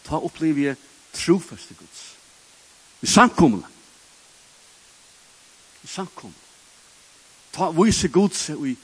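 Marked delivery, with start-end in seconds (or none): 3.0-3.7 called out loud and firm; emphatic. Acted. Preacher proclaiming to the congregation, loud. Repeats phrase, loud then hushed. 5.8-6.4 much softer, drawn back; hushed echo. Acted. Preacher echoing his point to the congregation, hushed. Repeats phrase, loud then hushed.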